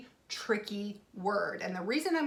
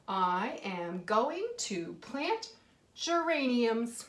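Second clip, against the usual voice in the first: very slow, word by word, rising on plant; slow dictation; much slower than usual. Shown as a demonstration. Teacher reads her sentence slowly to the children. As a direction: read it out slowly for the class. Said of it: "I didn't ask a question today".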